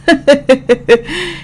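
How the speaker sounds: short high mocking chuckle; mocking laugh. Red-haired man laughing at the judge, mocking chuckle. Madman still laughing in court.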